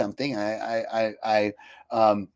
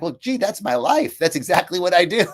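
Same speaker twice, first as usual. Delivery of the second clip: delighted realization; 5 semitones higher, 5 dB louder than usual. Retold or imitated.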